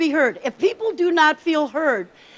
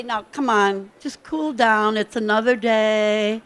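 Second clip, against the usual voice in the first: lower, slowing, placating tone; soothing cool-down; 4 semitones lower, slower than usual. Shown as a demonstration. Coach demonstrates calming someone upset the wrong way, low and placating. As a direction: talk someone down, too calmly. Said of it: "So if you say".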